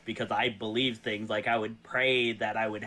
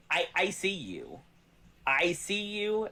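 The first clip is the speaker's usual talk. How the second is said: heartfelt; 7 semitones higher than usual. Real, not acted. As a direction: speak it straight from the heart. Emotional thanks to the chat.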